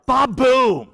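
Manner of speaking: booming mock drum rimshot; imitated drum hit. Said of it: "Now we need a drummer".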